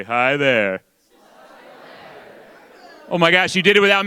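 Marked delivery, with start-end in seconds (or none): 0.0-0.8 low-pitched salesman greeting. Shown as a demonstration. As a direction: greet them like a smooth-talking salesman. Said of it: "in your best salesman voice".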